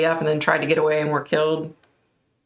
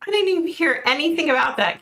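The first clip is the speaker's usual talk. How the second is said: surprised; 11 semitones higher, faster than usual. Real, not acted. Surprised reply to comment.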